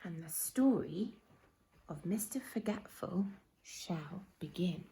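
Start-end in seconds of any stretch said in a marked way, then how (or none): 1.9-4.8 whispered, mysterious opening; hushed announcement. A narrator's reading. Storyteller opening the tale for children, softly whispered. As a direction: let the title land in a hush.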